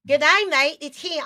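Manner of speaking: high, loud Australian good day; mock Australian. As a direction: sing it out like an Aussie. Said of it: "even Australians are like".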